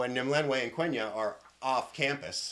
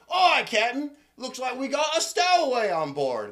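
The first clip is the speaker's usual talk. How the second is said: high, loud deckhand shout; raised, hammed-up crewman voice; reporting sailor; 12 semitones higher, 10 dB louder than usual. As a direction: holler the news up to the captain. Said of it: "you hear somebody say".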